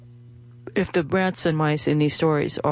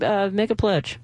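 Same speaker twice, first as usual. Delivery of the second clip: ordinary delivery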